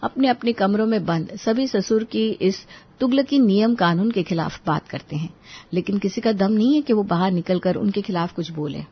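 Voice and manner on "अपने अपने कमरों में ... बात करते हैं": usual speaking voice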